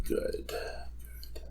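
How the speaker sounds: whispered to self while typing. Real, not acted. Muttering the search word under breath at the computer. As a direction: mouth the word as you search. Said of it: "muttering while searching word".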